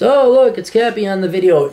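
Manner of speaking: hammed-up cheery exclamation; mock viewer excitement. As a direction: imitate an excited fan, pitched well up. Acts out what viewers say.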